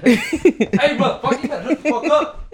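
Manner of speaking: high, loud laughing yell; yelling at the dog. Retold or imitated. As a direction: shout it through a laugh. Yelling at dog, mock threat.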